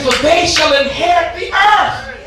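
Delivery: building preached shout rising to a high peak; preaching at full force. Acted.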